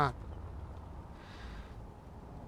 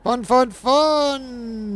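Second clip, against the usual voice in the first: slow, higher, louder mock enthusiasm; sarcastic cheer; 8 semitones higher, 8 dB louder, much slower than usual. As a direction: drawl the fun out sarcastically.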